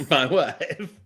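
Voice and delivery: "my wife" catchphrase in a put-on foreign accent; comic foreign-accent impression; Borat. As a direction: do a jokey catchphrase impression, breaking into laughs. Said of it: "I trust Borat more than anyone".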